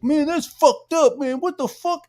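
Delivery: very high-pitched, loud mock dismay; Yellow Flash. Friend instantly reacting to the posted message, mock-shocked high voice. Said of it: "I like your impression".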